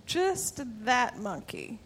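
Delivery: usual speaking voice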